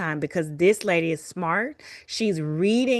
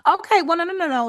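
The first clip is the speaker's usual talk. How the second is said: high, louder burst of placating; hasty backtracking; 9 semitones higher, 4 dB louder, faster than usual. Retold or imitated. Doctor conceding so the patient stays, hasty and high. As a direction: jump up high to stop someone leaving.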